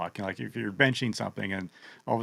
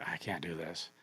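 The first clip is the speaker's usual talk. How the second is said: defeated mutter; 7 semitones lower, 15 dB quieter than usual. Retold or imitated.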